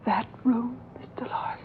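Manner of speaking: whispered dread; fearful whisper. Acted. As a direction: gasp it out in a hush. Maid fears the room.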